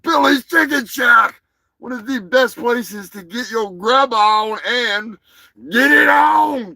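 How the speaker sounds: loud cheesy announcer; shouting ad. Acted. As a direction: yell it like a TV ad. Shouted ad slogan.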